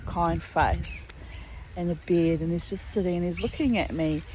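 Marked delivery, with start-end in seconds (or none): none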